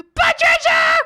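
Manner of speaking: a high shout of the name; the coworker's high shout of Patricia; excited shout. Retold or imitated. Coworker shouting her greeting.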